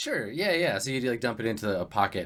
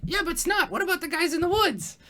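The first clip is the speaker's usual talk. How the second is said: much higher, squeaky small goblin voice; small goblin asking the boss about the guys in the woods, squeaky high; squeaky little goblin. Acted. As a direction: pipe up like a tiny goblin underling.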